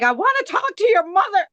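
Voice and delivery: retold outburst at a late-night scam caller; mock furious. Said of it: "I was ripping the hell out".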